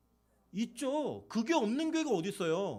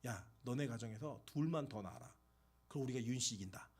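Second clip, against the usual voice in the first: softer, joking aside to a friend; 8 semitones lower, 10 dB quieter than usual. Retold or imitated. Pastor teasing a deacon about family size, soft and joking. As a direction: tease him quietly, half in jest. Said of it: "제가 임봉균 집사한테 이랬어요".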